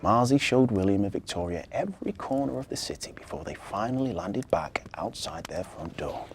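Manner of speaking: ordinary delivery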